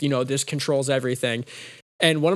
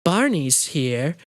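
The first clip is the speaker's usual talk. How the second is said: slow, drawn-out cartoonish announcement; playful Barney announcement; much slower than usual. Purple-skinned hero joking at himself, slow playful announcement. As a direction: lightly put on a purple-dinosaur voice. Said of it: "and he's like".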